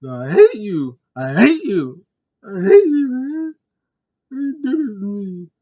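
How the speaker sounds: high, loud, crying outburst; emotional Jey. Retold or imitated. Jey screaming at Roman that he hates him, crying loudly. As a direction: sob it out at full voice, heartbroken. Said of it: "Jey was emotional".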